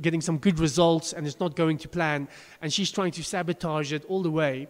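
ordinary talk, nothing marked

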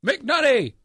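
loud barked name, pitched high; drill sergeant yell. Retold or imitated. First sergeant yelling at recruit.

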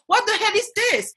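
high-pitched comic indignation; appalled mock rant; exasperated outrage. Real, not acted.

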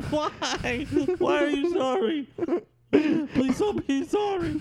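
high, giggly plea; laughing mock pleading. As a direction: plead while cracking up. Acting scared passenger, laughing.

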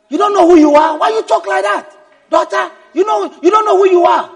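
high-pitched, loud disbelief; indignant protest. Acted. An admirer scolding someone for not knowing their worth, indignant. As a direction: protest as if they undervalue themselves.

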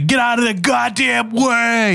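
slow high East Coast yell; East Coast road rage. Retold or imitated. Imitating an East Coast cop yelling at a car. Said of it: "he's an East Coaster".

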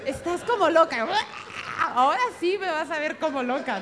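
más agudo y fuerte, en broma; ofendida, subiendo la voz en broma; indignación exagerada. Retold or imitated. Indignación fingida ante "loca. (indignación en broma, más fuerte y aguda)

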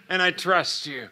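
soft close to a retold prayer; hushed trusting prayer. Man telling God he trusts him, hushed prayer.

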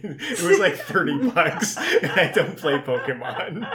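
high, loud, laughing through the words; laughing confession. Real, not acted. Host confessing a costly card craving to co-hosts, laughing. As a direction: admit it while cracking up.

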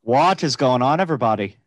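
rough attempt at an Irish accent; vaguely Irish put-on accent; Irish-ish accent. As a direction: greet everyone in a loose Irish lilt. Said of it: "Irish-ish".

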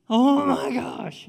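loud, high, slow exclamation; mock freak-out. Servant seeing the armies surrounding them, mock freak-out. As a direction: stretch it out in hammed-up alarm. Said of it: "like, freaking out".

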